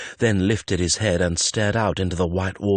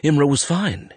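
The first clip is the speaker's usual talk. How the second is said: high, anxious self-talk; fretful inner insistence; reassuring himself; 8 semitones higher, 3 dB louder than usual. A narrator's reading. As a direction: convince yourself, voice pitched up. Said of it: "he told himself".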